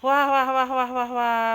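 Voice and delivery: muffled wah wah talk. Real, not acted. Amazed at the finished chair.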